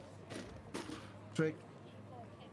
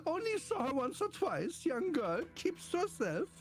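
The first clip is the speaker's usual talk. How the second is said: higher, complaining neighbour being questioned; fussy neighbour in character; 12 semitones higher than usual. Acted. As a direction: play the put-upon resident. Plays neighbour questioned by officer.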